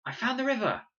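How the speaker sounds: loud, high, thrilled; excited call. A narrator's reading. Boy calling to friends he found the river, excited shout. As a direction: announce the find with a shout.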